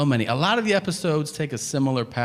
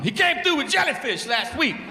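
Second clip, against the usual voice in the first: high, loud, laughing street voice; hammed-up street witness talking fast; aggressive witness; 5 dB louder than usual. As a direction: push the answer at the cops. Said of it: "he speaks super aggressively to the cops".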